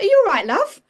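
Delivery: high, loud British greeting with a big swoop; strong British accent. Retold or imitated. Healer checks on the woman who tripped, strong British accent. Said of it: "this really strong British accent".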